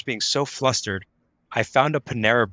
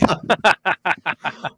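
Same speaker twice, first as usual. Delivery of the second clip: bright, higher burst of laughter; real laugh; 5 semitones higher, 4 dB louder than usual. Real, not acted. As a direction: laugh at your own joke. Laughs at own joke.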